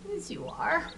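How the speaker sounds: high, soft coo. Real, not acted. Keeper telling a chick it's a cutie pie, soft coo. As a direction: coo softly to a little pet. Cooing over a tiny chick.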